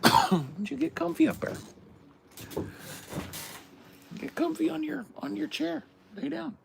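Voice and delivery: very high pet-talk voice; talking to the dog. Acted. Settling the dog onto its chair, very high doting pet voice. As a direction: coo at the dog way up high, sing-song.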